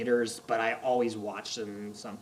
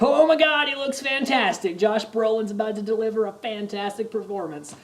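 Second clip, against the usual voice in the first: high, loud awestruck outburst, easing off; retold high-pitched fan raving; screaming fanboy excitement; 8 semitones higher, 6 dB louder than usual. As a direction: gush loudly at a thrilling reveal. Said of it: "screaming at the top of my lungs".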